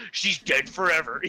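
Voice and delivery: low, solemn imitation; Friar Sand, serious. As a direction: deliver it gravely in a deep mock voice. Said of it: "gets all serious with her".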